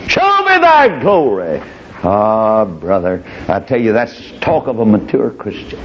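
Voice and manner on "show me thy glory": high, slow, drawn-out cry; climactic plea. Acted. Old Moses begging God for a glimpse of glory, slow high cry. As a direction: stretch it high and longing.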